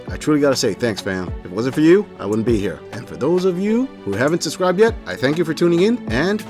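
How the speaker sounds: ordinary talk, nothing marked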